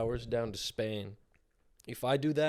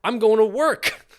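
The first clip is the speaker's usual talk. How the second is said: much higher, louder mock outrage, slowing; exasperated comic yell; 11 semitones higher, 12 dB louder than usual. Real, not acted. Cohost yelling that a long drive only gets him to work. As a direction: shout the punchline in mock despair.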